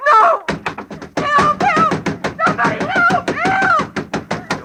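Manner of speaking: high, loud desperate cries for help; calling for help. Acted. Trapped woman screaming up the air shaft for help.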